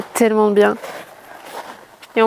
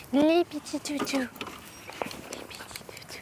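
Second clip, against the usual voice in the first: soft whisper to the dogs; 4 semitones higher, 15 dB quieter than usual. Real, not acted. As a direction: whisper tenderly to the puppies. Murmure aux chiens. (chuchoter aux petits chiens)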